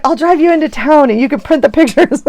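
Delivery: high, laughing offer; teasing daughter. Retold or imitated. Daughter teasing mom from the next room, high and laughing. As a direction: offer it like a cheeky teen. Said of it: "She's like".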